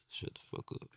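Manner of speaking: whispered mock curse; hushed aside. Parent confessing what they never told their child, whispered. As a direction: say it under your breath, barely voiced. Jokingly admits wanting to say it.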